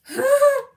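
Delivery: a high, loud burst of laughter. Real, not acted. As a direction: laugh out loud at your own joke. Laughs after the accent joke.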